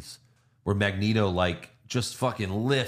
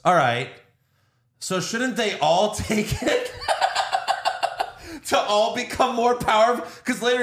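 laughing while quoting; 11 semitones higher than usual. Retold or imitated. Retelling a friend's question at the movie, laughing higher. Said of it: "Joe goes".

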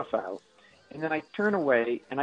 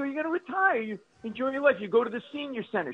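put-on higher-pitched pleading with an elderly parent; exasperated urging; 9 semitones higher than usual. Son telling his mother to retire, exasperated. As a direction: push the advice in a higher voice.

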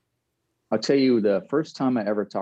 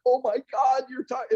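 high excited friends. Retold or imitated. Friends shaking him during the call, high excited. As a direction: gush in disbelief. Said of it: "They're shaking me like".

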